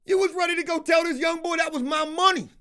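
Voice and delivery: high-pitched, incredulous confrontation; heated, high-pitched disbelief; indignant accusation. Real, not acted.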